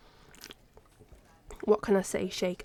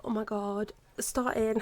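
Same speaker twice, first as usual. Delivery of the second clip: usual speaking voice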